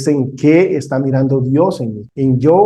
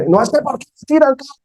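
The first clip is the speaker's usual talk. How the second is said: much higher and fast, an indignant yell; angry protest; 11 semitones higher, faster than usual. Retold or imitated. Offended biker confronts the driver through the window, high fast indignant yell. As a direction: fire back like the offended biker.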